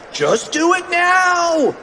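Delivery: high, drawn-out frantic order; shrieked cartoon command; urgent mock scream. A narrator's reading. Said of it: "screamed Fluffy".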